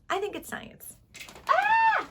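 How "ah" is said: sudden high startled cry; startled yelp. Real, not acted. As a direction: yelp in surprise.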